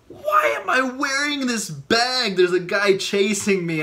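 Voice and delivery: high, urgent exclamation while fleeing; acted panic. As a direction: play it panicked, pitch jumping up. Reenacts panic while fleeing robber.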